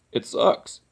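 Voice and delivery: a choked, breathy near-whisper of grief; real grief catching in the throat; trying not to cry over a lost best friend; voice breaking. Real, not acted. Grieving his dead friend.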